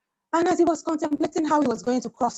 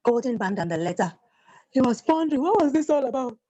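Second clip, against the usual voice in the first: ordinary delivery, nothing marked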